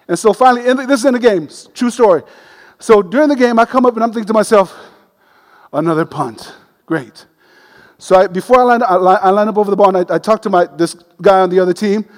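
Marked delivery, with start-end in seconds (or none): 5.7-7.2 low, soft deadpan muttered sarcasm; sarcastic thought. Retold or imitated. Center thinking before the snap, soft sarcastic dread. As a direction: dread another punt under your breath.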